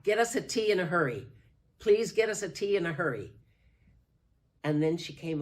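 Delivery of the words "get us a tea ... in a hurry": loud fast call for tea; retold yell. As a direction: shout it out quickly. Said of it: "she started to yell".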